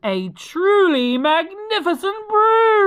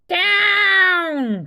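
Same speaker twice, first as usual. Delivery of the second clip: higher, louder call of down; cried down; 9 semitones higher, 5 dB louder than usual. Acted. Witch ordering the broom to land, calling out. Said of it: "cried the witch".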